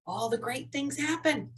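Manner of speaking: ordinary talk, nothing marked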